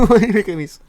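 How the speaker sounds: breaks into loud high laughter mid-word; laughing too hard to finish the question; cracking up mid-sentence, loud and high. Real, not acted. Laughing retelling annoyance.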